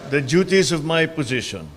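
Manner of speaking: solemn, measured oath-taking; court oath. Acted. Administers the oath of office.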